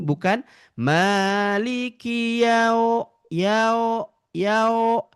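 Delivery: yau dropped slightly toward O; wrong yau. Shown as a demonstration. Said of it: "Kalau yau, dia itu ada sedikit ke bawah". (yau salah, agak ke bawah)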